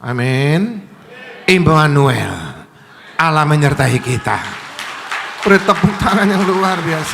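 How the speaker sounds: loud, drawn-out proclamation; rally the congregation to applaud; full-force preaching. Real, not acted. Preacher proclaiming Immanuel to the congregation, loud rallying preaching.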